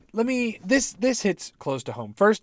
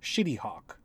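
muttered; 3 semitones lower, 5 dB quieter than usual. Shown as a demonstration. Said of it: "That's my title there".

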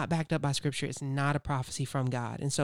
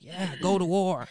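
higher, drawn-out mock cheer; eager chorus of agreement; 4 semitones higher, 4 dB louder, slower than usual. Prophets all urging the kings to war, mock-eager cheer.

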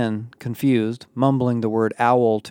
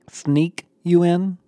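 slow, higher, mocking echo; sarcastic echo; 7 semitones higher, 3 dB louder, slower than usual. A narrator's reading. Isaiah mocking Emma's request, slow and sarcastic. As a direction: toss the question back with scorn. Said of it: "Isaiah echoed sarcastically".

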